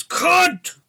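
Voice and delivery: high, loud scream; explosive high-pitched yell; screamed curse. A narrator's reading. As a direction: scream it as you slam the phone down. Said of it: "slams down the phone and screams".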